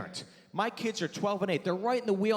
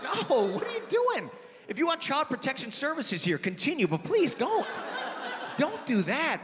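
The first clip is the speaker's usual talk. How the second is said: high, flustered mock protest; mortified scolding; 6 semitones higher than usual. Dad stops son in the restaurant, high mortified scolding. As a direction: beg the kid to stop, comically panicked.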